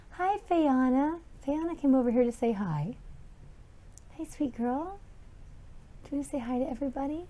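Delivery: high, soft greeting to a pet; sweet animal talk. Real, not acted.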